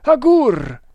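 loud, high shout for help; urgent call. A narrator's reading. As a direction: yell for your companion to come. Calling companion in alarm.

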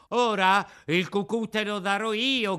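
loud, high, mocking yell; shouted threat. A narrator's reading. Boldest boy threatens Pinocchio, loud mocking shout. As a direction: bark the threat at the puppet. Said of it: "gridò".

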